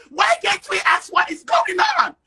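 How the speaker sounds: loud angry demand, first take; angry shout. Real, not acted. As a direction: shout the question in anger. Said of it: "you sound very angry".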